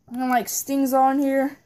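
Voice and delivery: ordinary talk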